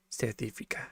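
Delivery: South African accent attempt. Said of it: "Trying to get that accent down".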